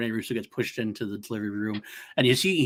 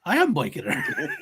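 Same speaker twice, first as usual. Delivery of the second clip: high, slow, laughing through it; giggling boast of the line; laughing quote; slower than usual. Retold or imitated. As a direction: crack up mid line.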